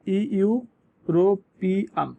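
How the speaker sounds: ইউরোপিয়ান stretched very slowly, starting with a clear ই sound; slow drawn-out demo. Shown as a demonstration. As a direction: draw out ইউরোপিয়ান syllable by syllable. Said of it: "ইউরোপিয়ান উচ্চারণটা কি ইউরোপিয়ান না".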